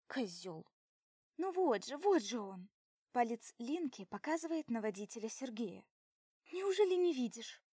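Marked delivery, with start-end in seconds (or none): 0.1-0.7 low contemptuous mutter; scornful snort. Acted. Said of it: "мысленно фыркаю". 1.4-2.7 louder eager pointing out; insist, pointing him out; excited. Acted. 6.5-7.6 high, loud disbelief; higher and louder, incredulous; exasperated. Acted. Friend exasperated.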